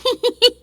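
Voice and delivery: high, loud little giggle; a mischievous child tittering. A narrator's reading. April Fool laughs at Aunt March, high loud titter. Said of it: "tittered little April Fool".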